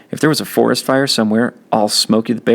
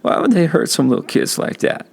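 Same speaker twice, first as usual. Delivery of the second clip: high, tearful plea; crying question; 7 semitones higher than usual. A narrator's reading. Kenny crying to his brother in the bathroom. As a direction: ask through tears. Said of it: "My throat was jumping around".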